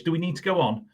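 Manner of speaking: ordinary delivery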